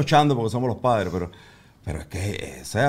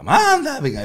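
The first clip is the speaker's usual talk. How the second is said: much higher and louder, "manda pinga" sung out; higher, louder toddler take; 12 semitones higher, 6 dB louder, slower than usual. Retold or imitated. Dad imitating his daughter's rising "manda pinga" on video. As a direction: let "manda pinga" jump up in pitch like a child testing it. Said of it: "lo fue cambiando de tono".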